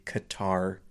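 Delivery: Qatar said kuh-TAR, stress on the end; Qatar with an ordinary front k; Qatar, the way North Americans say it. Shown as a demonstration. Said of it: "we say".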